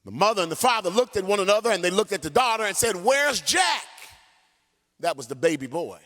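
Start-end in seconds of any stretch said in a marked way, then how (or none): 2.9-3.8 alarmed cry. Retold or imitated. Parents realise son missing.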